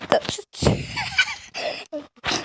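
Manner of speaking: high squealing cry of a laugh; shrieking laugh. Real, not acted. Vlogger shrieks with laughter at a rival's video. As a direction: burst into a piercing cackle.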